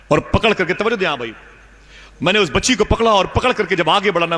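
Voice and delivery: high, driving preaching build; high impassioned telling. Acted. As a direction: push the story to its peak. Preacher builds the killing scene. (اونچی جذباتی آواز میں)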